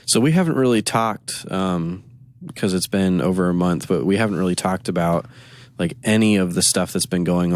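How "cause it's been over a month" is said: ordinary talk, nothing marked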